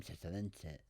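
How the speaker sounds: normal talking voice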